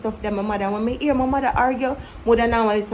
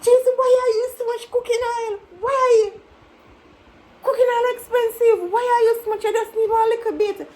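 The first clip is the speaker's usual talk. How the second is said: mock wailing mother; 12 semitones higher than usual. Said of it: "I say".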